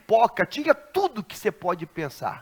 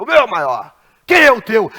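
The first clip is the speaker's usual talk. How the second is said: louder, puffed-up boast; growing boast; 5 semitones higher, 14 dB louder than usual. Retold or imitated. Driver growing bolder, asking the pastor, loud. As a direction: swell with pride.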